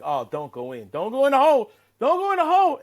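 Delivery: will the ball into the cup; rising excited plea to the ball; urging the ball. Retold or imitated.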